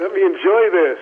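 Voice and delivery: high, hammed-up playful menace; fake-threaten him, half laughing; mock threat. Rookie teasing the coach who burst his bubble, playful mock threat.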